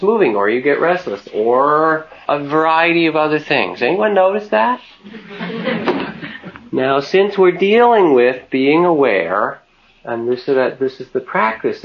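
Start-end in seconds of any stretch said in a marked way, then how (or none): 5.1-6.5 soft laugh. Real, not acted. Teacher laughs with the group.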